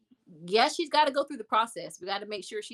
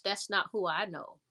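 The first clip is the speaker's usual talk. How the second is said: ordinary talk, nothing marked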